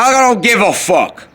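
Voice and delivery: sudden high, loud outburst; retold brush-off. Cutting off the smoking guy with a loud high outburst. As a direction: snap it out, cutting him off. Cutting off the smoker mid-answer.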